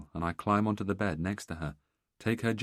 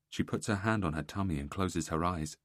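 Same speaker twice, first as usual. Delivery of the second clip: ordinary delivery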